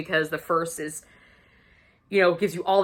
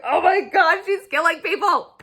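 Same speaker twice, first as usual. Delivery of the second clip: re-enacted high, loud fangirl shriek; thrilled reader's outburst, pitched way up; excited squeal; 10 semitones higher, 4 dB louder than usual. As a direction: squeal it with giddy glee. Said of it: "So excited".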